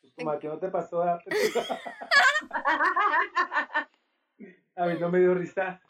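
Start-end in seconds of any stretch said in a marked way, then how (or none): 1.2-3.8 high giggle, laughing at himself; laughing through pain. Real, not acted. Laughing at own mishap.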